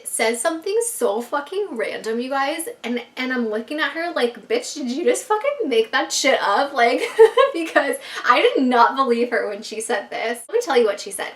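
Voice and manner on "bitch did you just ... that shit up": incredulous sass. Retold or imitated. Disbelief at random prediction.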